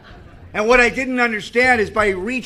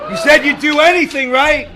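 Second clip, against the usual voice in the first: louder, much higher, pressing for agreement; mock insistent challenge; 7 semitones higher, 6 dB louder than usual. God holding a man to his promise, mock insistent. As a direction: push it out loud and high as a challenge.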